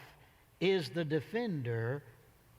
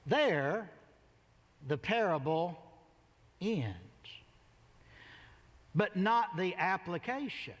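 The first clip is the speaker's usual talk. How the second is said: ordinary talk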